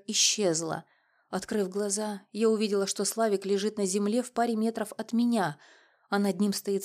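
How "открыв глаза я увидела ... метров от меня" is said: usual speaking voice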